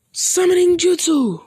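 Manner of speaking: high, slow call of the technique name; dragging out the summoning call, pitched high; jutsu battle cry. Acted. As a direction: cry out the summoning like an anime hero. Calling out the jutsu.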